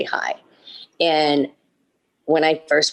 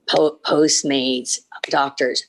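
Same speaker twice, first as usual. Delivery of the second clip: ordinary talk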